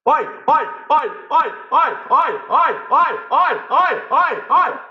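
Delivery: very high, loud, repeated oi calls; joyful high calls. Shown as a demonstration. Voice coach demonstrating a loud release exercise. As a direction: shout oi oi for pure joy. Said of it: "crying from joy".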